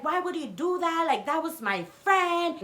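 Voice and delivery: mock his aggrieved protest; imitated mad man. Boyfriend mad she confronted him, imitated indignant protest. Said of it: "He's mad that she went up to him".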